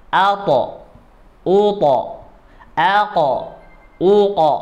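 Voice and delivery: alto, uto, alko, uko all thick and dark; heavy syllables. Shown as a demonstration. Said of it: "Kalau dinaikkan jadi al". (dibaca tebal)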